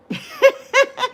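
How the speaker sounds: bursting into a high laugh; sudden high giggle. Real, not acted. Laugh alone on line.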